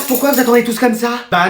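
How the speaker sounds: normal talking voice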